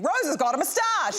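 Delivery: high-pitched mock scream; retold scream. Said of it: "Shania just screaming".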